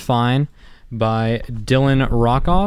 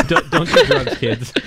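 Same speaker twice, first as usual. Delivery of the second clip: laughing through a mock PSA; cracking up mid-line; 6 semitones higher, slower than usual. Jokey PSA said while laughing.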